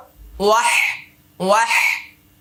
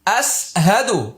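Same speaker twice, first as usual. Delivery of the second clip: slow recitation; slower than usual. Shown as a demonstration. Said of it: "এবার মুখস্থ করে নিন".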